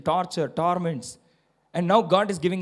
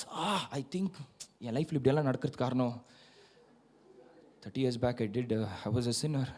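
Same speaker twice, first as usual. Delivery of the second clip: muttered self-blame; 5 semitones lower, 8 dB quieter, much faster than usual. Retold or imitated. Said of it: "bang somewhere".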